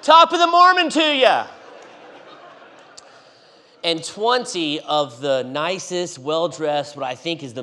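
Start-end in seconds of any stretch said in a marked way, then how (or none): 0.0-1.5 high, loud hammed-up greeting yell; retold yell. Preacher yelling his greeting into the welcome center. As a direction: bellow it through the door as a joke. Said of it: "and I yell".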